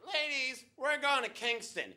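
high-pitched mock party girl; party girl announcement. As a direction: announce it high, like rallying the girls. Imagined friend rallying the girls.

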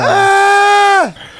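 sudden high yelp of disbelief; stunned high-pitched yell; shocked shout. Real, not acted. Reacting to the shocking twist.

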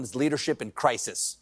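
ordinary delivery

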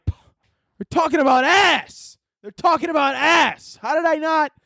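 high, slow, stunned outburst; shocked realization. Retold or imitated. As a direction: stretch it out in disbelief. Sudden realization while driving.

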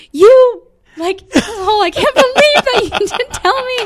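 loud, very high mock outrage; outraged theater teacher. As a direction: imitate a flabbergasted teacher, pitch soaring, laugh breaking in. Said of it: "My theater teacher who".

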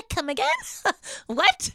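high-pitched, incredulous double take; stunned disbelief. Retold or imitated. Woman retelling her shock at learning it's a swingers resort, stunned. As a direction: act out being floored, voice jumping up.

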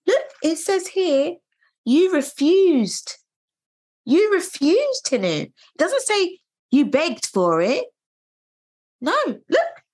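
urgent, accusing retelling of the nurse; insistent pointing out. Retold or imitated. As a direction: look here, you refused, pressed hard and high. Reenacting midwife showing notes.

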